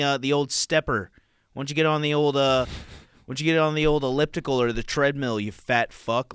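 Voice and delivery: nagging Apple Watch. Acted. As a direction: needle him loudly like a pushy gadget. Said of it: "The Apple Watch goes".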